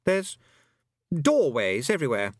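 put-on boyish voice sinking from high pitch; boy tailing off. A narrator's reading. Roland describing doorways to other places, tailing off. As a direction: start high, let the boy falter away. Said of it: "his voice tailed off".

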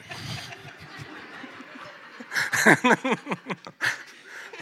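high breathless laugh; high breathy giggle, caught out; embarrassed laugh. Real, not acted. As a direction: burst out laughing at the tease. Teased about pastor crush.

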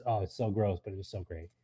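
ordinary talk, nothing marked

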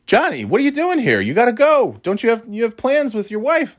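high-pitched mock mom voice; imitated mother. Mom calling out to her son in the yard, high imitated fussing.

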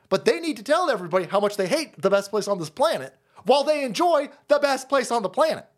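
ordinary delivery

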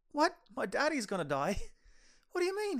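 mock disbelief, pitched well up; high-pitched incredulous questions; shocked disbelief. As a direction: play a stunned son, voice jumping high. Acting the shocked son's reaction.

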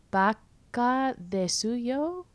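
slow, drawn-out Spanish accent on the name. Shown as a demonstration. Vlogger saying a website name to viewers, mock Spanish accent. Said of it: "saying it in a Spanish accent".

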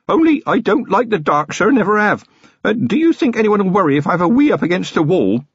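high-pitched, anxious junior officer; nervous young constable. A narrator's reading. Feeney to Vimes, who don't like the dark, nervous. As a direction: confess timidly in a high voice.

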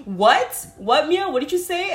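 the boss can't believe her ears; stunned boss asking again; shocked boss. Retold or imitated. Boss hears she's high.

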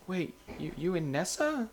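a mock gasp of disbelief; surprised. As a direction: retell his shocked double take. Gavin's surprised retold reaction.

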